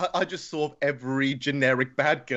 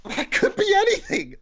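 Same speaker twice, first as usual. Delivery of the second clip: very high-pitched, louder outburst; exasperated protest; 3 dB louder than usual. Real, not acted. Stumped contestant to the quizmaster, high exasperated protest. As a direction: protest it way up in a squeak.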